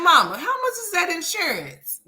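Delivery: high-pitched mock innocent question; high innocent ask. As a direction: play the innocent daughter asking mama. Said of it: "I'ma be like".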